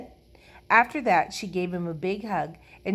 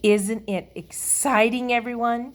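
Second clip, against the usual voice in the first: excited; 6 semitones higher, 4 dB louder than usual. A narrator's reading. Lucille rallies the children about her prize, excitedly. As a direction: gush to get everyone worked up.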